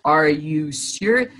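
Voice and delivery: slow, low-pitched "you can't mean it"; stunned disbelief. Retold or imitated. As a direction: drop the voice low and draw it out, dumbfounded. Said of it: "I'm sitting there going".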